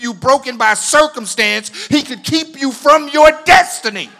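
loud sermon cadence climbing to shouts; fiery preaching. Real, not acted. Preacher urging congregation out of self-doubt, forceful preaching. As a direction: keep pressing, build to a roaring finish.

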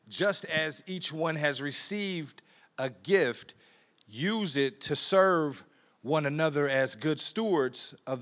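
slow, loud, weighty scripture read. Acted. Preacher reading Peter's letter to the church, slow solemn reading. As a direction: lay each word down slowly and loudly.

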